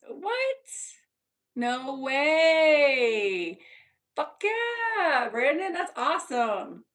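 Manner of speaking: high, drawn-out cheer easing down; real excitement, stretched-out vowels; thrilled. Real, not acted. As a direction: react with delighted disbelief. Thrilled reaction to what Brandon did.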